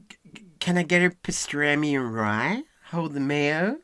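not-quite New York accent, drawn out and rising; attempted New York accent. Shown as a demonstration. Pupil tries the coach's phrase, amateur New York accent. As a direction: have a slow, questioning go at New York. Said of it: "it's not quite New York".

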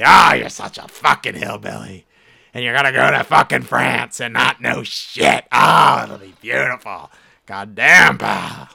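loud, far-off put-on tough-guy voice; hammed-up bar-stool rant at a movie star; loud mock rant. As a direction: yell at him like a gruff barfly buddy. Character yelling insults at Damon.

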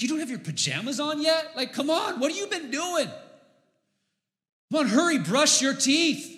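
put-on high, loud, exasperated hurrying of the kids; impatient parent. Dad rushing his little kids through bedtime, exasperated and loud.

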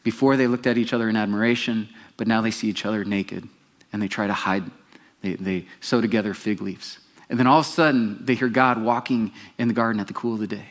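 ordinary talk

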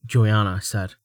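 ordinary delivery, nothing marked

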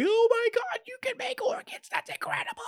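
very high squeaky excited voice; high-pitched childlike excitement; excited Chopper. Retold or imitated. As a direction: gush like a thrilled little creature.

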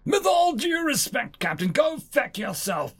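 high-pitched insulting yell; outraged yelling at a superior; angry bellowing. Acted. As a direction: start high and indignant, hurl the insult. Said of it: "bellowing so loudly that everyone could hear him".

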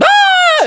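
high, loud scream of panic; acted scream. Guests running from the frog, high panicked scream. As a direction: shriek as if fleeing contagion.

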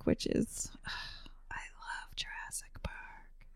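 breathy whispered adoration; whispering. Real, not acted. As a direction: whisper it like a secret love. Dreamy confession of love for ride.

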